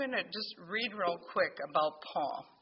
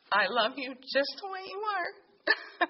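higher, slow, loving reassurance; tender voice of the Lord; 7 semitones higher, slower than usual. Retold or imitated. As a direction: hold her up gently with every word. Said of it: "the Lord just said to me".

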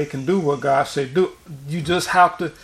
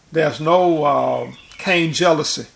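usual speaking voice

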